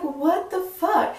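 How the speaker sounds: high, slow, drawn-out what the fuck; stunned disbelief. Retold or imitated. Stunned, drawn-out what the fuck at the cop's news. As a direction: stretch it out in disbelief.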